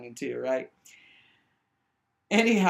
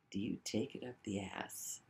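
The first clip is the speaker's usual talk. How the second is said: hushed question; 5 semitones lower, 10 dB quieter than usual. Retold or imitated. Jim's creepy question, retold.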